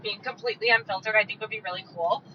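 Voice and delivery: ordinary delivery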